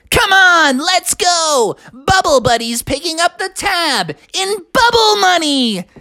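very high, excited cartoon sponge; rally your pals in a squeaky voice; eager SpongeBob. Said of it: "And he said".

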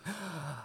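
high, soft mock gasp; excited gasp. Dad imitates his son running into the music room, excited gasp. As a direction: imitate a little kid's eager gasp. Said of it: "he's like".

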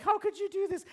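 high hammed-up child protest; child's mock wail. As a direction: whine like a wronged little boy. Crying son voiced.

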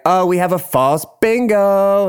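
high, hammed-up host announcement; drag queens announcing. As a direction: sing it out to the bingo crowd. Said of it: "And they're like".

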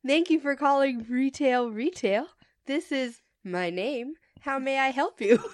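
bright retail phone answer; cheery customer service voice. Retold or imitated. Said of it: "Me, cheerily".

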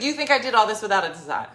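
loud, fast, offended demand; hammed-up indignant outburst; mock angry. The landscaper angrily defending his design to Dina. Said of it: "He got real mad".

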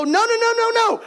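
high, rapid defensive no's; defensive protest. Retold or imitated. Saul denying to Samuel, defensive high-pitched no's. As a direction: protest your innocence, voice jumping up. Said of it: "Saul gets defensive to Samuel to go".

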